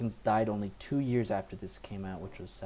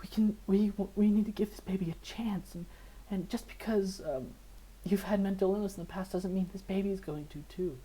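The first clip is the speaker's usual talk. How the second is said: pleading wife; 10 semitones higher than usual. Retold or imitated. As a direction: gently talk him down as his wife. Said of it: "and he's like".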